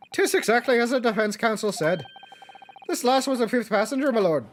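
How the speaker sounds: lightly voiced Irish old man; Irish accent.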